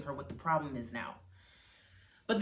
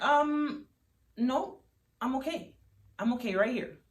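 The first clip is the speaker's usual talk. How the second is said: pointedly unbothered, pitched up; flippant refusal; 8 semitones higher than usual. A narrator's reading. Daughter refusing to help lift father, high and flippant.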